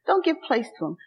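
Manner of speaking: ordinary talk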